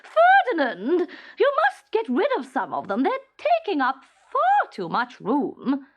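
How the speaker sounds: high, loud scolding queen; a furious wife telling him off; angry scolding. A narrator's reading. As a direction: berate the king for his socks. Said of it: "she stormed".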